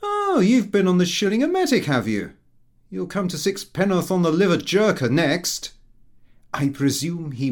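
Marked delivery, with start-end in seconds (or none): 0.0-5.7 higher, louder teasing voice; cheeky young man's jibe; mocking son. A narrator's reading. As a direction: tease your father. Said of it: "he said".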